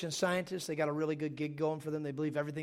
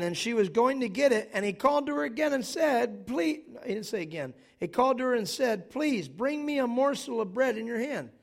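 loud, emphatic scripture preaching; 6 semitones higher, 6 dB louder than usual. Acted. Pastor preaching to the congregation, loud and emphatic. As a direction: proclaim the text with full force.

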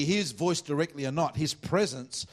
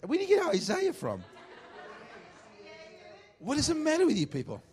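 mock exasperated squeal; 14 semitones higher than usual.

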